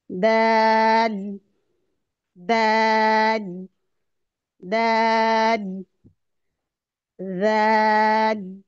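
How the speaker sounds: दाल stretched very long, very slow; drawn-out dal. Shown as a demonstration. Teacher drills long letter names for students, very slow stretched recitation. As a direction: hold the आ of दाल a long time. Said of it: "खींच कर लंबा करके पढ़ रहे हैं".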